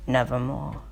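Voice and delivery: a low, ominous bird's answer; raven's reply. Acted.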